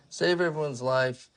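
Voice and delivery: plain reading voice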